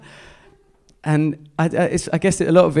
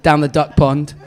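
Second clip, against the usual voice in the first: loud laughing jab, I've seen you; hammed-up accusation through a laugh; mock accusing shout; 2 semitones higher, 8 dB louder than usual. Retelling a comic shouting at an audience member, mock accusing. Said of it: "and he goes".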